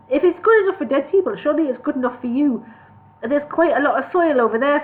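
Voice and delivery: earnest, naive suggestion; hobbit Bingo. Acted. Hobbit advising elves to live underground.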